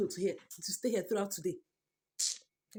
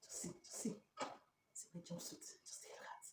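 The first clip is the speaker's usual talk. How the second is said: soft breathy whisper; defeated whisper; 12 dB quieter than usual. Real, not acted. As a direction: whisper it, beaten. Whispered despair.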